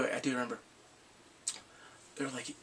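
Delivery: ordinary talk, nothing marked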